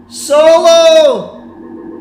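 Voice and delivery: high, loud excited call; excited shout. Real, not acted. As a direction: shout the name as it surges ahead. Streamer calls out marble race.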